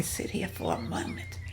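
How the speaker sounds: whispered, hurting and shaken; weak whisper. Acted. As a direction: barely voice it, catching her breath after a fall. Injured woman after a fall.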